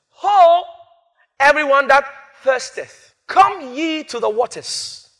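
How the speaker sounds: slow, drawn-out proclamation; solemn scripture reading. Acted. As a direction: call it out like a herald. Reads Isaiah aloud.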